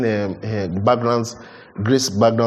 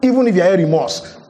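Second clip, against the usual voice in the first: impassioned preaching; 6 semitones higher than usual. Acted. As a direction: drive the debt image home with fire. Preaching at full force.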